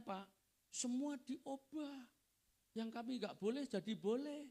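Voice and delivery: lightly acted parental grievance, pitch climbing; complaining parent. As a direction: imitate a parent bringing a complaint to the pastor. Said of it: "biasanya keluhannya orang tua begini".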